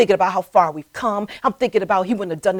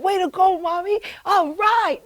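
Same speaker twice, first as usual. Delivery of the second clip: a hint of a boy cheering, much higher; lightly voiced son cheering; 9 semitones higher than usual. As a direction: cheer Mommy on like her young son. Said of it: "He was like".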